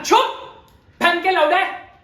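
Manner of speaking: अचानक ऊँची आवाज़ में फटकार; हकलाहट काटकर ज़ोर से चुप कराओ; गुस्से भरी झिड़की. Acted. रुककर हकलाते हुए अचानक झिड़कना. (गुस्से में डाँटते हुए)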